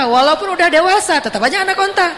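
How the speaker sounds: nada tinggi menekankan kelucuan; punchline jenaka. Real, not acted. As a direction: lempar punchline dengan suara naik. Delivering the punchline to audience. (jenaka, bersemangat, suara meninggi)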